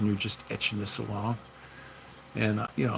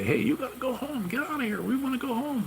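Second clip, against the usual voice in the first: much higher, squeaky mock voice of the guys; high mock voice, coworkers telling him to go home; mock coworkers' voice. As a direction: pitch way up to mimic coworkers shooing you out. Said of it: "and they'd say".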